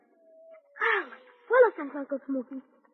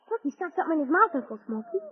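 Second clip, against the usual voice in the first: ordinary talk, nothing marked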